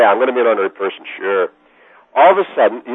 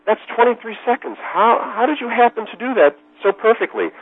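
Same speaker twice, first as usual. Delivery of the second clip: amazed vice president; 11 semitones higher than usual. Retold or imitated. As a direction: imitate a boss marveling, pitched well up.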